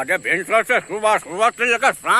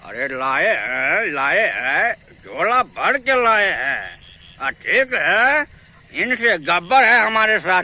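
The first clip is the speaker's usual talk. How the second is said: Lalu Yadav. Acted. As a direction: hold forth like a folksy Bihari leader. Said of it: "Lalu Yadav का हो".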